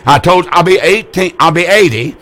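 ordinary talk

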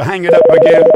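loud call off mic. Real, not acted. Telling staff to hang up line.

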